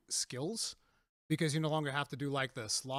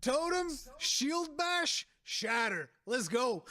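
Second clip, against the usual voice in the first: mock gamer hype; 9 semitones higher, much slower than usual. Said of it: "I was like".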